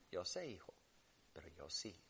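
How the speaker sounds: low, soft, reassuring father; calm father. Retold or imitated. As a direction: soothe the scared boy gently. Father calmly answering his son. (padre tranquilo que calma a su hijo)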